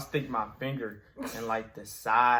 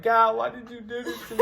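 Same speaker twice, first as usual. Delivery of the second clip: high, loud mock wail, laughing through it; laughing melodramatic plea; mock despair; 10 semitones higher, 6 dB louder than usual. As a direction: ham up the tragedy while laughing. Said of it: "I'm screaming. I'm crying".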